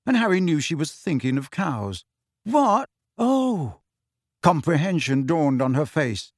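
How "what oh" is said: surprised realisation. A narrator's reading. Confused pupil suddenly understands.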